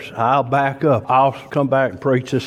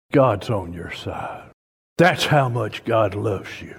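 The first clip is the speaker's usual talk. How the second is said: ordinary talk, nothing marked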